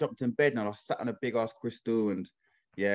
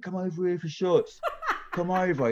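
high, laughing call across the bar; mock party lad beckoning; calling over girls; 8 semitones higher than usual. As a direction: laugh as you call them over for shots. Lads calling women over.

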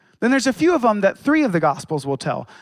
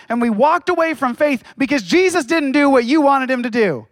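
higher, louder, urgent preaching to the congregation; fervent preaching; 5 semitones higher, 4 dB louder than usual. Acted. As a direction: press the challenge home like a pulpit call. Preaching at full force.

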